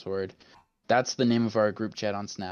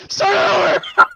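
high, loud laughing yell; laughing shout; 13 dB louder than usual. Real, not acted. Yelling restart through laughter.

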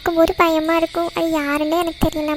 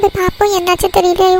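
normal talking voice

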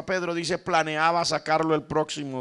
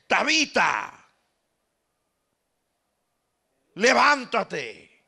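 slow commanding call; 5 semitones higher, much slower than usual. Acted. Peter raising the dead woman.

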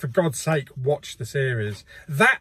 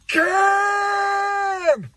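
Khan yell; 3 dB louder than usual. Retold or imitated. Quoting Kirk's Wrath of Khan yell.

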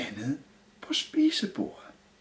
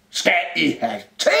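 raised, high-ending cupboard call; called-out question; 4 semitones higher than usual. A narrator's reading. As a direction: call it out as if from afar. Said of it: "råbte skabet". (råbt spørgsmål)

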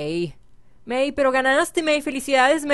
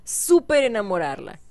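usual speaking voice

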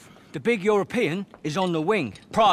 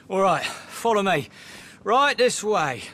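usual speaking voice

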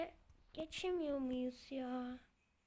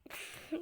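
short unvoiced laugh at his own frustration; a quick huff of a laugh; breathy exasperated laugh; 3 dB quieter than usual. Real, not acted. Player laughing after hours of failing, a short breathy laugh. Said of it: "artık gülebiliyorum şuna ya".

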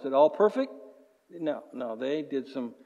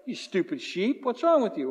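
exasperated scolding; 5 semitones higher than usual. Retold or imitated. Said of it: "it's like".

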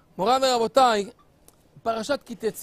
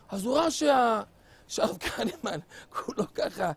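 ordinary talk, nothing marked

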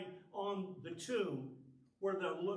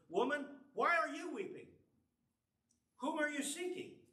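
gentle questioning Jesus; 7 semitones higher than usual. Acted. Jesus questions weeping Mary.

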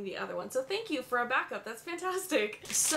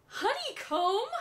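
delighted gasp; 10 semitones higher than usual. Real, not acted. Thrilled discovery of treat.

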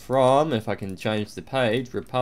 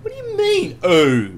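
mocking yell. Guard mocking a traveller's surprised oh, high shriek. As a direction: throw the traveller's oh back at him.

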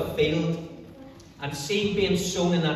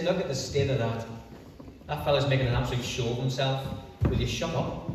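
scornful crowd; 7 semitones lower, 5 dB quieter than usual. Acted. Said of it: "everybody around him says".

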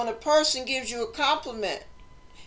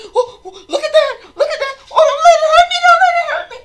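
much higher and much louder, frantic, with laughter breaking through; panicked tripping addict; 12 dB louder, faster than usual. Acted. Preacher imitating a tripping person seeing things, frantic high shrieks. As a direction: shriek it out like someone seeing bugs crawl up the walls.

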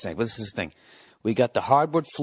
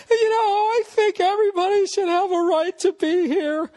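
much higher, louder falsetto mock of a liberal; mock blue-tie politician; 4 dB louder than usual. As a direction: squeak it out like a preachy politician. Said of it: "the guys in the blue ties are like".

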